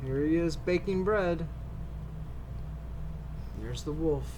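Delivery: ordinary delivery, nothing marked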